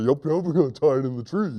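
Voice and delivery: fast goofy echo; silly hunter. Acted. Silly hunter copying the net plan, fast goofy voice. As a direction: parrot it quickly and dimly. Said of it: "He repeats everything his friend says".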